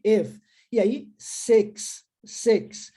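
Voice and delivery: "ef", "seks" bem devagar; e sound demo. Shown as a demonstration. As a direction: model "ef" and "seks" slowly. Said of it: "então é if".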